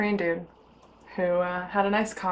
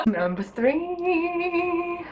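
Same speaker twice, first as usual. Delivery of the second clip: drawn out; 10 semitones higher, 4 dB quieter, much slower than usual. Real, not acted.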